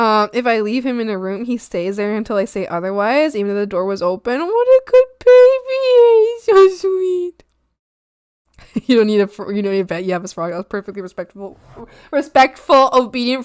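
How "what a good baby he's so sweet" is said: cooing praise. Real, not acted. Praising a viewer's obedient dog in high baby talk.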